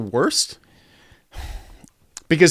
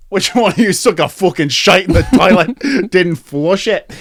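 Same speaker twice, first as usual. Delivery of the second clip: mock Scottish man; 4 semitones higher, 4 dB louder than usual. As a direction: grumble it in a light Scottish accent. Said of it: "the Scottish man".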